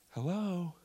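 put-on soft little child's hello; quiet, tender imitation of a small boy; imitated small son. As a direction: gently mimic a toddler's first hello. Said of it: "I hear my son say".

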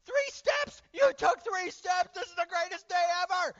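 very high, loud celebration; hammed-up overjoyed parent shouting; ecstatic cheering. Parent thrilled at baby's first three steps, ecstatic cheering.

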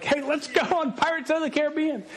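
a wife proposing the ride again, all cheer; cheerful wife. Retold or imitated. Wife to family at the park, suggesting the ride: high and breezy. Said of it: "she's like".

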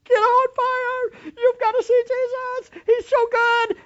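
very high, loud, slow scream; high, very loud preacher's scream. Shown as a demonstration. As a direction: scream it at the congregation. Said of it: "I want to stand up here and scream this".

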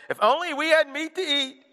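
whining Israelites. Retold or imitated. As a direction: wail for the old food like spoiled kids. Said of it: "whining, wailing, and said".

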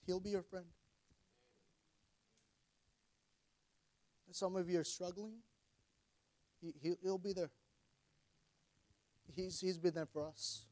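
holding back tears while speaking; blubbering. Real, not acted. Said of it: "Sorry, I'm crying".

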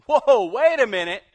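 loud, high startled protest. Retold or imitated. The prophet balking at God's command, loud and startled. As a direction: pull up short in disbelief.

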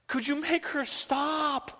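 high, drawn-out fed-up plea; exasperation. Acted. Disciples complaining to Jesus about the woman, acted exasperation. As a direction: beg for it to end, hammed-up. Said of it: "respond with that kind of exasperation".

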